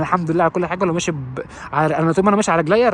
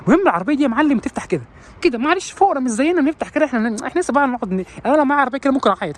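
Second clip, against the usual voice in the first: high, smug put-on voice; a high put-on voice bragging about the car; mock rich owner; 8 semitones higher than usual. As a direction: show off to the poor in a high voice. Mocking rich owner again.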